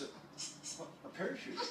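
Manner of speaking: incredulous echo. Retold or imitated. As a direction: mimic a stewardess baffled by the request. Retold stewardess's startled reply.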